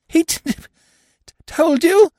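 high, panicky stammer catching on the t; frightened stammer. A narrator's reading. Caught in crime by the girl, high panicked stammer. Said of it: "he stammered".

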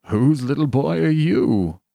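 put-on slow, kindly question to a child. A narrator's reading. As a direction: ask gently, drawing it out with amusement. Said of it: "he demanded kindly".

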